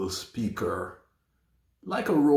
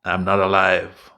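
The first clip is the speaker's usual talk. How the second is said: "I'm not alive" loud, low and run together; run-together prompt; 4 semitones lower, 6 dB louder than usual. Shown as a demonstration. Prompts toy with contracted phrase.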